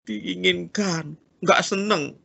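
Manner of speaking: ordinary talk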